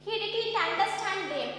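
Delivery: usual speaking voice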